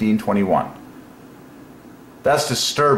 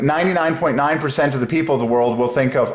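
ordinary talk, nothing marked

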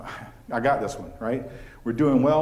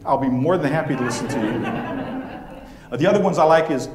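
chuckling through it, louder; laughing; 3 dB louder than usual. Real, not acted. Speaker jokes to the audience about stories, laughing. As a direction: let the laugh push the words out.

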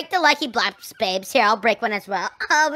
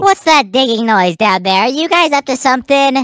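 suspicious mom; 2 semitones lower, 8 dB louder than usual. Acted. Mother calls down suspiciously to the digging kids. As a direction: demand to know what they're up to. Prime Woman hears digging.

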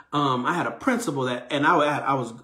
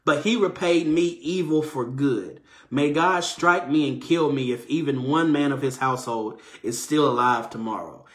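ordinary talk, nothing marked

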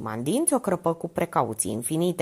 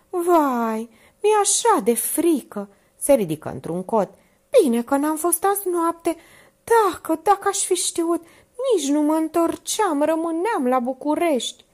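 high, fretful frightened voice; higher anxious maid voice; scared maid; 11 semitones higher, 4 dB louder than usual. A narrator's reading. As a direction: fret aloud in fear. Minerva confesses her fear.

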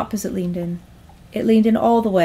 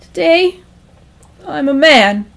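high, halting resolve; raised-pitch, shaky-confidence echo; holding back feelings; 5 semitones higher, 5 dB louder than usual. Acted. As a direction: declare it bravely while choking down feelings. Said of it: "Calvin gulped down his feelings as best he could".

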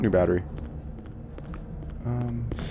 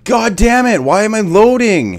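high, loud frustrated yell; real rage, shouted high and loud; angry shout; 11 semitones higher, 10 dB louder than usual. Real, not acted. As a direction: blow up at the loading screen. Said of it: "I don't know why I'm getting so mad".

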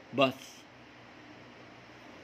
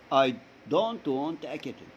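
slow model sentence; much slower than usual. Shown as a demonstration. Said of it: "teacher models sentence for class".

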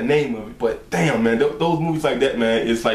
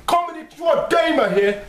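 shout; 14 semitones higher than usual. Real, not acted. Shouted call to viewers.

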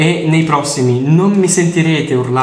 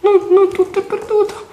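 put-on much higher, squeaky little boy pleading with dad; cartoonish child voice, much higher; high kid voice. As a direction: play the boy in the game in a high voice. Voices the kid character again.

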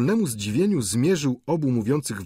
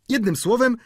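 wtrącając się; 13 semitones higher, 5 dB louder than usual. A narrator's reading. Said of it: "przerwał student".

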